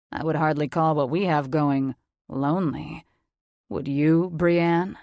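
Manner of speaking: a mature man, calm and sure of himself; authoritative confident man. Acted. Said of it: "The man's voice was authoritative, mature, and confident".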